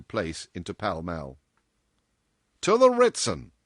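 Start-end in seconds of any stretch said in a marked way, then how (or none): 2.6-3.5 loud, high, decisive answer; brusque boss. A narrator's reading. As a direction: announce the destination in full voice. Boss answers her question.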